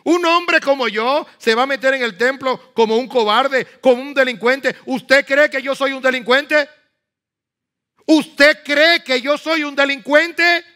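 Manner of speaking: high, loud, outraged challenge; bold indignant reply. Retold or imitated. Nehemiah refusing to hide in the temple, loud and indignant. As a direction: throw the question back fiercely. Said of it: "No fue así que dijo Nehemías".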